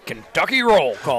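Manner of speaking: slow, put-on intro-man voice; hammed-up voice-over announcer; show intro announcer. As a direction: imitate a drawn-out radio station intro. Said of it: "the guy who does some of our intros".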